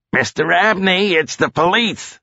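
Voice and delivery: high-pitched police announcement; yelling. A narrator's reading. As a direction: call out with authority to someone upstairs. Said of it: "Dino yelled".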